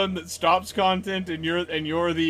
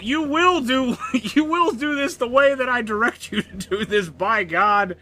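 bossy; 7 semitones higher than usual. Pretend. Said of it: "show Nazi'd you".

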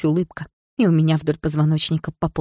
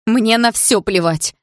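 high, drawn-out outburst; exasperated, raised voice; defiant cry; 6 semitones higher, 4 dB louder, slower than usual. A narrator's reading. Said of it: "выкрикнула я".

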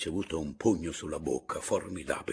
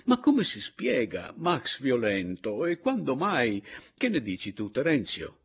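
loud, high, incredulous questioning; baffled agitation; 7 semitones higher, 7 dB louder than usual. A narrator's reading. Signor Anselmo asks Terenzio about violent Max, baffled. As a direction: puzzle out loud, peaking midway.

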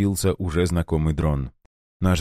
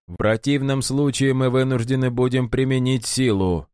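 tired but unshaken; 6 semitones higher than usual. A narrator's reading. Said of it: "звучал устало, но невозмутимо".

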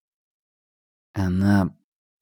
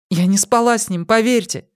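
put-on higher, hesitant young woman; 11 semitones higher than usual. A narrator's reading. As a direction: confess timidly as a young woman. Pip voiced by narrator.

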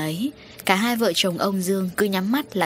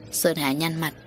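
ordinary delivery, nothing marked

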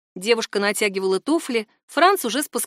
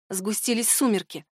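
ordinary delivery, nothing marked

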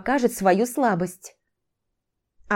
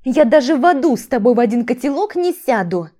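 higher, louder outraged refusal; scornful, heated protest; indignant refusal; 4 semitones higher, 5 dB louder than usual. A narrator's reading. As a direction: refuse him flatly and heatedly.

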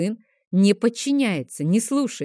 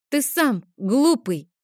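put-on childish retort; 6 semitones higher, slower than usual. Sister's retort to brother.

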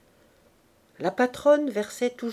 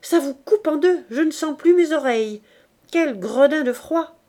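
higher and louder complaint; complaining of cold; 6 semitones higher, 8 dB louder than usual. Acted. Virginie complains about the freezing cold, loudly. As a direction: shiver out the words. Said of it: "dit-elle".

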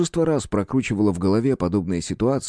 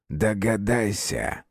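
suggestive hunter; much slower than usual. A narrator's reading.

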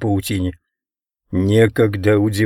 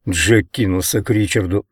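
plain reading voice

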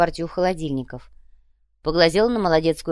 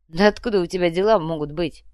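plain reading voice

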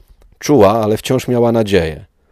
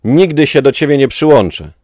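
a high, loud refusal with NIGDY hit hard; a furious, high cry of refusal; defiant shout; 7 semitones higher, 6 dB louder than usual. Acted. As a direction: shout it at him, refusing to give in. Ahsoka defies Vader.

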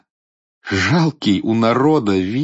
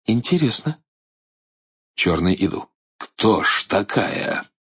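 usual speaking voice

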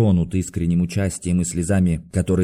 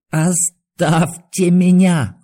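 drawn-out, high-pitched outcry; frenzied cry; 8 semitones higher, 4 dB louder, much slower than usual. A narrator's reading. Doctor driving off his friends, frenzied cry. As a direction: cry out in a voice not his own. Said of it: "крикнул он не своим голосом".

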